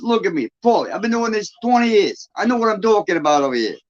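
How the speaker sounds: high-pitched Long Island tough guy; imitated Long Island working man, indignant; Long Island accent. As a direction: brush him off in a put-on Long Island accent. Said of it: "I can do, like, Long Island".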